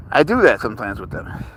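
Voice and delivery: usual speaking voice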